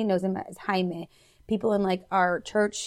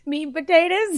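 mock high voice; 9 semitones higher, 5 dB louder than usual. Teasing the host.

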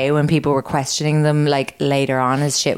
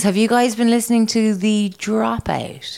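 ordinary talk

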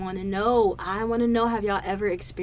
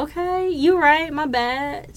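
raised-pitch mock apology; sheepish concession; 7 semitones higher, 5 dB louder, slower than usual. Retelling own reply to her unexpected answer, mock sheepish.

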